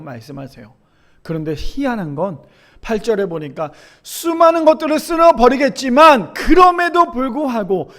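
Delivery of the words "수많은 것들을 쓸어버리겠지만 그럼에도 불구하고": high, ringing sermon delivery; preacher building to the turn; forceful preaching. Acted. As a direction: proclaim the verse to the congregation. Preaches scripture at full force.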